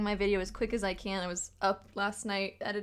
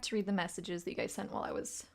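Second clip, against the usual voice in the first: whispering; 4 semitones lower, 6 dB quieter, faster than usual. Real, not acted. Quietly reading chat messages.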